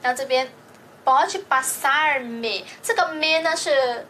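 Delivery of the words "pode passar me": very slow, carefully separated words; slow model reading. Shown as a demonstration. Teacher models the next Portuguese example, slow and deliberate. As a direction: draw out "pode passar-me" piece by piece.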